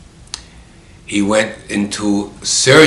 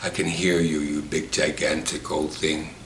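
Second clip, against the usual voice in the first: low, soft post-surgery voice; retold groggy patient; 5 semitones lower, 10 dB quieter than usual. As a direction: murmur a teasing jab from a hospital bed. Voicing patient just out of anesthesia.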